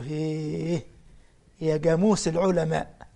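put-on an old Saidi man calling out "جاموس" with a hard g; lightly voiced elder from Upper Egypt, praising the sheikh; Upper Egyptian villager. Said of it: "عايز يقول له يا قاموس". (رجل صعيدي كبير يمدح الشيخ بلهجة صعيدية خفيفة)

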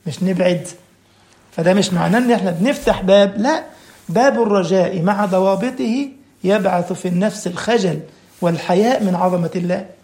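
ordinary talk

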